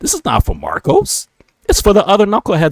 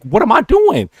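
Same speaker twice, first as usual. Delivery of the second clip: baffled protest; 8 semitones higher than usual. Retold or imitated. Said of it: "I'm like".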